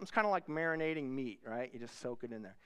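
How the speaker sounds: ordinary talk, nothing marked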